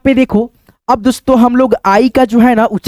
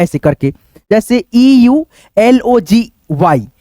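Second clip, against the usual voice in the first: ordinary talk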